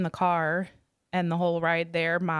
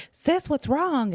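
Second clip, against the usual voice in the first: worried question; 8 semitones higher, slower than usual. Retold or imitated. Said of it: "and I'm like".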